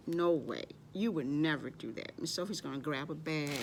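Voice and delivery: ordinary talk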